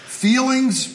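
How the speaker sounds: Feelings called out high; call-and-response prompt. Shown as a demonstration. Pastor leading the church in repeating, high call. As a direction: lead the congregation with a high ringing Feelings. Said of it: "I want you to say".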